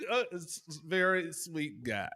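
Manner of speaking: ordinary delivery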